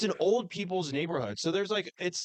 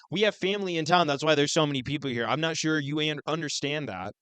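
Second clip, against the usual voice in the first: normal talking voice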